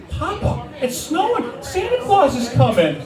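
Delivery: put-on high excited little kid voice; child voice. Grandson telling grandpa about snow, high and excited. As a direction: chirp it like a thrilled six-year-old.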